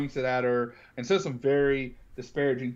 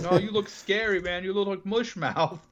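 higher teasing voice, mocking the mask; 6 semitones higher than usual. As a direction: tease him about the homemade mask. Friend mocking the ski mask.

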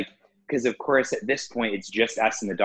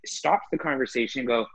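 ordinary talk

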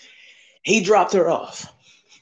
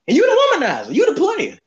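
laughing; 6 semitones higher than usual. Retold or imitated. Mocking the cousin, laughing.

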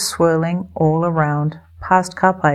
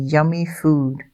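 ordinary delivery

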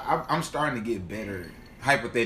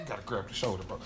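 usual speaking voice

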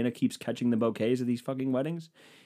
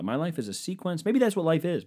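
ordinary talk

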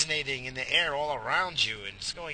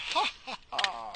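high, deliberate "ha ha ha"; fake laugh; 7 semitones higher than usual. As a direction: laugh hammily at your own joke. Laughs at own corny joke.

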